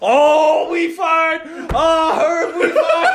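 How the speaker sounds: high, loud comic wail of doom; panicked wailing. Retold or imitated. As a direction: lament in hammed-up panic. Voices Barry panicking about firing.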